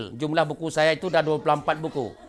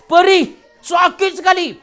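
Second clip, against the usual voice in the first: high, loud pained cry; mock pain; 10 semitones higher, 5 dB louder than usual. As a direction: act out stinging pain.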